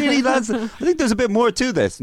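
very high mock student voice; high-pitched protest. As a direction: squeak out an incredulous objection. Acting out doubtful student in class.

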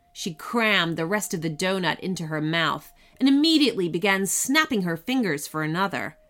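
ordinary delivery

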